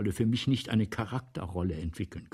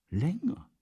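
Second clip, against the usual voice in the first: usual speaking voice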